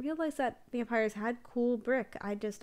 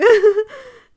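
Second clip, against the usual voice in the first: loud bursting laugh; 12 semitones higher, 19 dB louder than usual. Real, not acted. Laughs at short video.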